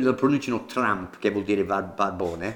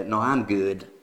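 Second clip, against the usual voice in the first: put-on American accent; 4 semitones lower, slower than usual. Teacher voices an American declining, American accent. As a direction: lower American-accented reply. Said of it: "L'americano direbbe".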